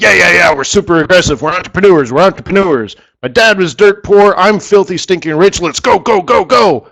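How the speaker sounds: hyped investor. Retold or imitated. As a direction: pump it up like a boastful tycoon. Voicing an eager rich investor.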